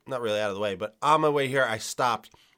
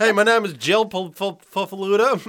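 mock introduction; 6 semitones higher, 6 dB louder than usual.

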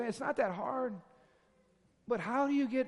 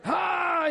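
sudden shouted ha; 9 semitones higher, 8 dB louder than usual. Retold or imitated. Sudden burst mid-story.

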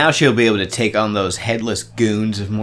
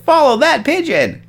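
mock command; 4 dB louder than usual. Mock cab chase command.

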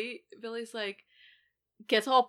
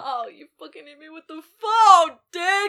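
much higher, louder pained yell; outraged yell; 9 semitones higher, 11 dB louder than usual. Retold or imitated. Podcaster quoting Stu's ad-libbed line, loud. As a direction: shout it like real pain. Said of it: "he's just like".

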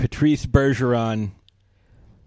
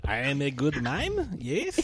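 softer mock French Canadian accent; self-satisfied mime in put-on French Canadian; Patrice Bergeron; 9 dB quieter than usual. Mime praising himself, softly in French Canadian accent. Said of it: "pitch perfect French Canadian accent".

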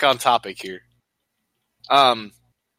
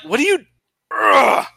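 high-pitched imitated disbelief; hammed-up shocked disgust; mock appalled reaction; 11 semitones higher, slower than usual. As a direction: act out a grossed-out onlooker, ending in a groan. Acting out appalled onlookers.

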